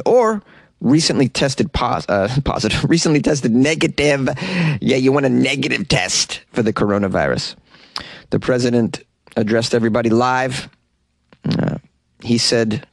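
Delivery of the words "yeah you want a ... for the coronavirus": usual speaking voice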